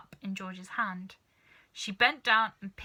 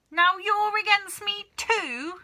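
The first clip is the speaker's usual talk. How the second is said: put-on a much higher, louder, slow old woman's shout; 12 semitones higher, 8 dB louder, slower than usual. Grandma shouting at her daughter for siding against her. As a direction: wail it out as betrayed. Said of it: "shouted Grandma".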